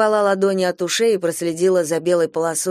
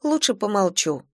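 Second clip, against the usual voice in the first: plain reading voice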